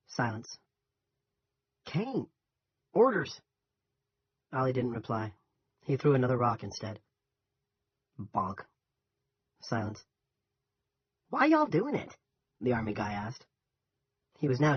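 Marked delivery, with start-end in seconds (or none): 1.9-3.4 high-pitched Southern soldier voice; young Southern soldier. A narrator's reading. 11.3-12.1 high, louder Southern question; young Southern soldier, puzzled. A narrator's reading.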